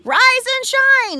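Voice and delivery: very high, loud, slow wake-up call; frog shouting. Acted. Tiny frog waking the whole forest, a high loud shout. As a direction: crow it like an alarm clock. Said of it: "he shouted".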